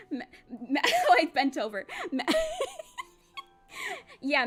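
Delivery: stammering through giggles; giggle, flustered and caught out; laughing. Real, not acted. Embarrassed laughter at guest.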